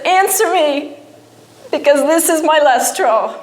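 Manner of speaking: high-pitched desperate demand, no real shout; retold yell at God. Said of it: "I yelled at him".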